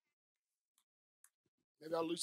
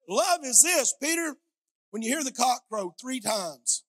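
louder, higher fervent sermon delivery; full-force preaching; 6 semitones higher, 8 dB louder, slower than usual. Acted. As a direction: preach it out to the congregation.

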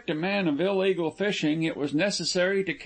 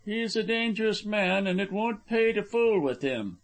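high, sustained warning tone; pressing, pitched well up; insistent warning; 7 semitones higher than usual. A narrator's reading. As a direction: warn him earnestly off a dangerous man. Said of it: "he repeated".

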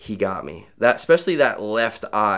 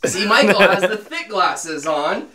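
laughing; 9 semitones higher, 7 dB louder than usual. Real, not acted. Laughs at own mistake.

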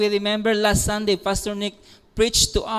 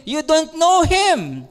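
bold rebuke; 7 semitones higher, 5 dB louder than usual. Acted. Jesus rebukes the Pharisees.

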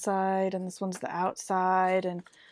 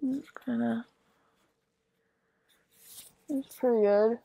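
muffled behind cloth mask; speaking with mouth covered; through a face mask; 3 semitones higher than usual. Real, not acted. Seen 0: Fitted cloth mask covers her nose and mouth throughout the words.

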